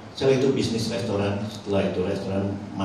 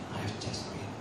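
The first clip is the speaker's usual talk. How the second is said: breathless whispered "I have chest pain", much softer; faint whisper; 11 dB quieter than usual. Retold or imitated. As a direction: let it fade to a dying whisper. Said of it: "dia bilang".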